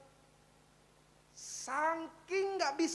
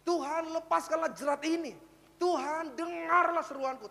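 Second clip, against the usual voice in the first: loud, raised cry for help; pleading prayer; 4 semitones higher, 6 dB louder, slower than usual. Acted. David crying to God for his dying child, pleading. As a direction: call out to God in mock desperation. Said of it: "Dia katakan".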